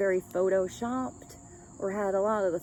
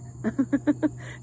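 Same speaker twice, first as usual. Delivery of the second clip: a short high giggle; amused giggle; 4 semitones higher than usual. Real, not acted. Reader laughing to herself about British humor, giggling.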